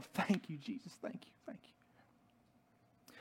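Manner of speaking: dropping away into a whisper; murmured praise, trailing off to almost nothing; fervent thanks fading. Acted. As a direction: let the thanks fade to a breath. Ecstatic acted prayer dying away.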